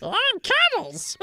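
high, slow creepy clown; drawn-out sinister clown. Acted. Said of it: "sounds like a really creepy clown".

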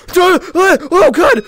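high, loud stammered cries of fright; startled yelling. Real, not acted. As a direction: yelp in bursts as you're startled. Jump scare.

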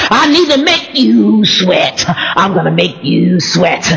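slow, drawn-out creepy villain threat. Pretend. Preacher pretending to threaten pastors, creepy slow voice. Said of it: "I ain't gonna say it like that 'cause, ew, that's creepy".

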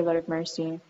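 ordinary delivery

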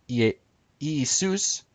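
false start on Ie-, then Iisus as ee-SOOS; modern Greek pronunciation. Shown as a demonstration. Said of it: "modern Greek".

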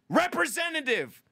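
very high-pitched exasperated yell. Acted. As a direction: interrupt the robot with a piercing shout. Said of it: "How come yelling representative".

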